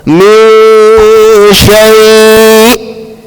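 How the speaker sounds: min shay chanted long, halted in the throat at the end; complete stop. Shown as a demonstration. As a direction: cut shay off dead on the hamza. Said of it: "come to a complete stop".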